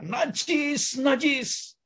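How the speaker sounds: high, drawn-out mock warning cry; shouted warning. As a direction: imitate lepers calling out to keep others away. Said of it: "mereka berteriak".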